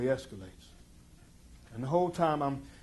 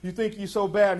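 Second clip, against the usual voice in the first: loud high taunting yell; screaming threat; 9 semitones higher, 12 dB louder than usual. Retold or imitated. Rival kid taunting from the car, loud high threat. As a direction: bark it out like a street kid itching to fight. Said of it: "starts screaming".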